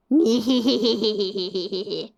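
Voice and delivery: low, gleeful villain giggle; evil giggle. Acted. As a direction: cackle over the humans.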